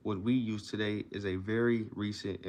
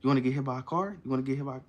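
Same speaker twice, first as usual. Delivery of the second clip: higher, energetic dog talk; excited rising question to a pet; enthusiastic; 4 semitones higher, 4 dB louder, much faster than usual. Shown as a demonstration. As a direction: ask it brightly as if it were good news. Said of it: "And then also say".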